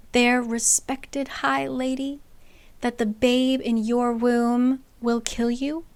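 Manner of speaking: lightly voiced spiteful Nesta; cold reveal. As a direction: drop the secret slowly. Nesta revealing the deadly secret.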